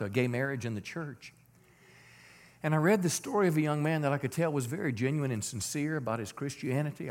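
normal talking voice

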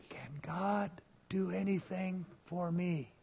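imitating a faint, slow whisper through a damaged throat; voiceless whisper. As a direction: barely get the words out, as if without a voice box. Man with bandaged throat, lost voice.

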